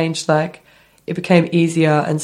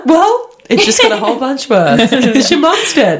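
high, louder taunting inner voice; mock anxiety voice; 6 semitones higher, 4 dB louder than usual. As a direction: taunt yourself like the hangover anxiety. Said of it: "It's like".

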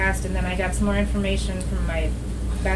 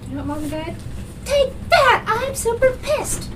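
dog's defiant voice. Retold or imitated. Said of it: "Rue was like".

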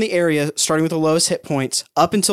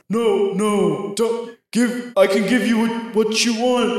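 higher, halting plea; pleading disembodied voice; 4 semitones higher, much slower than usual. Acted. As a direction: bargain nervously as the mysterious voice. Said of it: "you hear the voice again".

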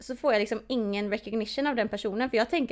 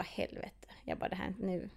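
hushed unvoiced curse; 1 dismayed whisper; 3 semitones lower, 11 dB quieter, slower than usual. Whispering in dread as the friend brings the blindfold. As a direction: whisper it under your breath in dread.

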